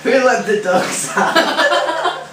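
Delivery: slow, laughing, sung-ish quote; half-sing it while cracking up; laughing song quote. Retold or imitated.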